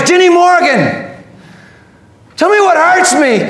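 high, fervent summons; crying out. Acted. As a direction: call out by name at preaching pitch. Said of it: "And then he cries".

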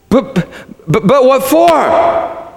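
high, drawn-out stammered plea; stammer, then stretch the question high; stammering boy. A narrator's reading. Shasta asking the lion why, stammering high.

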